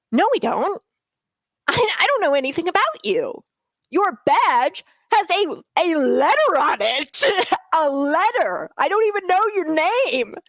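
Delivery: rising indignation at the badge letters; worked up. Real, not acted. Host fires back at the election judge, worked up and indignant. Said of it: "I get real worked up about this".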